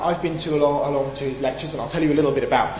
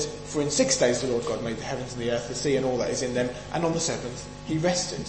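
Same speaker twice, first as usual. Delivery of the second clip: softly quoted verse; 4 semitones lower, 7 dB quieter than usual. Acted. Quiet quoted Exodus verse.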